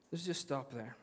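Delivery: quiet echo of let's just stop there; soft repeat. Shown as a demonstration. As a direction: repeat it softly after a long pause.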